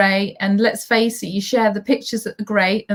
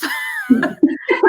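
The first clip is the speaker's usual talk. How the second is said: high laugh. Real, not acted. Host joking that it isn't enough, high laughing burst.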